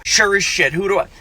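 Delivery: ordinary talk, nothing marked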